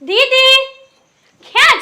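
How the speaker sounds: calling out loud and rising for her sister. Acted. Younger sister calling out loudly to find her elder sister. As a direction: shout for her across the house.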